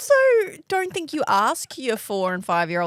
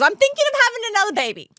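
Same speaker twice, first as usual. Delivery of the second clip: mimicking; 10 semitones higher, 4 dB louder, much faster than usual. Co-host mimics friend's announcement.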